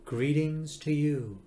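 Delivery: very slow, stretched-out formal greeting; hollow announcing voice. A narrator's reading. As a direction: greet like an eerie old recording. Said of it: "a voice that was equally hollow".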